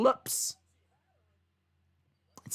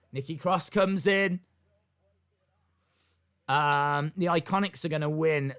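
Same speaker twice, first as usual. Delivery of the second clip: ordinary talk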